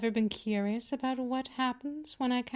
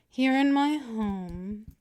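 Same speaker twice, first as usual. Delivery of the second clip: ordinary delivery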